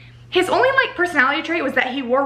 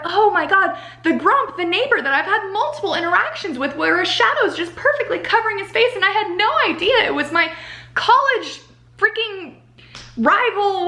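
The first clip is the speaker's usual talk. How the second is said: higher, excited gasp of discovery as the heroine; mock shocked realization; 6 semitones higher than usual. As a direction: play her dawning horror, half-joking.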